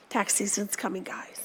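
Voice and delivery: a whispered joke; whispered aside. Real, not acted. Joking aside about tax collectors.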